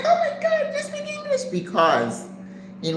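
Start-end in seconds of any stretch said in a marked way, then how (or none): 0.0-1.4 high-pitched squeal of disbelief; amazed relief. Retold or imitated. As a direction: act out astonished relief, way up high. Retells her relief at hearing English.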